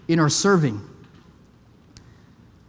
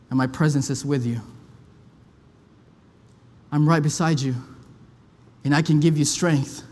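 tender God voice; 5 semitones lower than usual. Acted. Preacher voices God comforting Erwin, low and tender.

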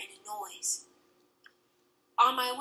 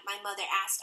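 ordinary delivery

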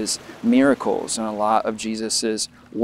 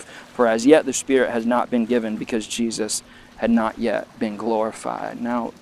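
ordinary talk, nothing marked

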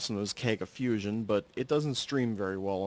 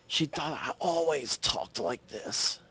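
slow, hoarse rasp; raspy sick voice; 3 semitones higher, slower than usual. Shown as a demonstration. Telling viewers about the new cashier, in the raspy voice. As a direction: drag it out through a sore throat. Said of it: "I had to talk like this".

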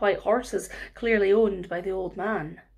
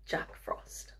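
hushed reveal; secret whisper; 14 dB quieter than usual. A narrator's reading. Storyteller reveals name.

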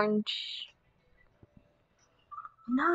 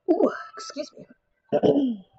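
hiccup; 4 semitones higher, slower than usual. Real, not acted. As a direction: caught by a hiccup, then excuse me. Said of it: "Little hiccup right there".

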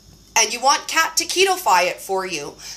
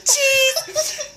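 ordinary talk, nothing marked

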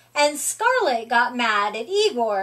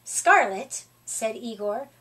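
ordinary delivery, nothing marked

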